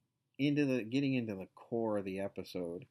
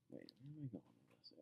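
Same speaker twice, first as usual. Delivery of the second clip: muttered aside; 6 semitones lower, 15 dB quieter, faster than usual. Real, not acted. Podcaster loses her train of thought, muttering softly to herself. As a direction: drop to a soft mutter, to yourself.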